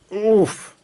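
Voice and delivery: high, punchy grunt of impact; the thud of a hit; cartoon oof; comic oof. Impact sound.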